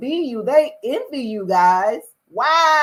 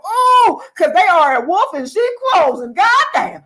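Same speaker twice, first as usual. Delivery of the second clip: higher and louder, worked up; heated high warning; excited warning; 6 semitones higher, 6 dB louder than usual. Real, not acted. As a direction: sound the alarm to the viewers.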